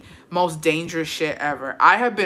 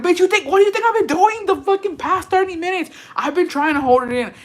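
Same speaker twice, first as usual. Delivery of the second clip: exasperated outburst; 12 semitones higher than usual. Retold or imitated. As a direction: fire it back in disbelief. Exasperated retort in retold fight.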